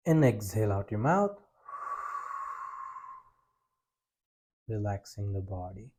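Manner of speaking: slow, soft breathing guidance; slow deep breaths. Shown as a demonstration. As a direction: guide each breath calmly and slowly. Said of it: "Let us do together".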